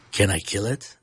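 lightly imitated low, deadpan question; a hint of the actor's low delivery; Peter Dinklage. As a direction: drop the pitch for the quote, lifting at the end. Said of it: "the way he says, "Can I kill it?".

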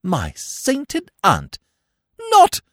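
very high, loud, drawn-out shock; aghast exclamation. Acted. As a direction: gasp it out slowly, then shoot up. Bertie aghast at dawning realisation.

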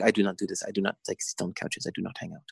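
a hint of French accent, quiet refusal. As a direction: quote her with a mild French accent, dropping away quietly. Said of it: "in her French accent".

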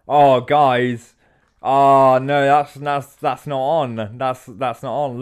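hammed-up indignation, drawing out the protest; mock protest. [name] objects to the presenters, loud mock protest.

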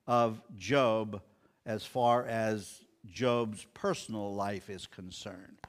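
usual speaking voice

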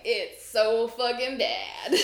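slow, laughing stress on bad; laughing emphasis. Real, not acted. Co-host laughs explaining how bad the weather is. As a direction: drag it out while laughing.

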